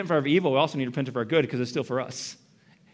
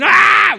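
loud high mock scream; baby screaming; 13 dB louder than usual. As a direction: burst out in an imitation infant shriek. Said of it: "Now the screaming".

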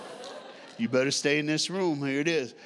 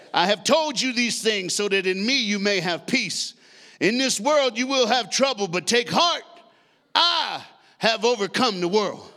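louder, rising preacher reading; scripture read with force; 4 semitones higher, 4 dB louder than usual. Acted. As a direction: proclaim the verse.

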